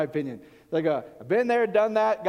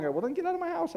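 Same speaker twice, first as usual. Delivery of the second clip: mock retort; 11 semitones higher than usual. Retorting to Minnesota visitor.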